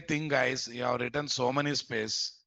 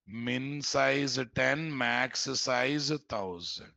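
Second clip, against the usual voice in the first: each word spaced out for typing; slow dictation; much slower than usual. Shown as a demonstration. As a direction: read it slowly for students to copy. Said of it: "dictating config values slowly".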